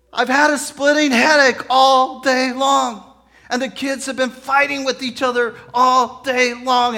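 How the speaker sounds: high, loud, hammed-up wailing complaint; acted exasperated wife's outburst; upset wife. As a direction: pile up grievances at the top of the voice. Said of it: "she burst into tears".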